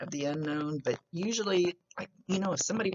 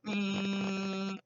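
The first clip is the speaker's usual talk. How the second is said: long, higher monotone hum; imitated flatline tone; 4 semitones higher than usual. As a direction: hum like a heart monitor flatlining. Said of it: "flatlined and made that horrible".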